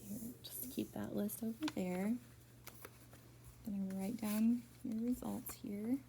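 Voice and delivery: soft whisper, writing down results; hushed aside to the student. Real, not acted. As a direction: whisper it to yourself while you jot notes.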